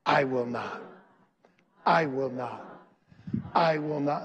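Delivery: low, firm "I will not"; call and response. Shown as a demonstration. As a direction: lead the congregation in "I will not". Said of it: "Say it with me".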